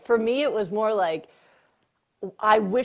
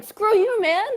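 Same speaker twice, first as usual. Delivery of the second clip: high, loud mock outburst at him; angry retort; 11 semitones higher, 5 dB louder, slower than usual. Venting to a friend about the ex, a high loud mock outburst. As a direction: snap it back at him, much higher and louder. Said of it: "'cause I'm like".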